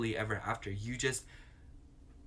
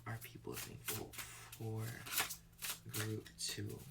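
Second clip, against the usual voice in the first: hushed question; 11 dB quieter than usual. Real, not acted. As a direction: ask the cards quietly. Asking the oracle deck.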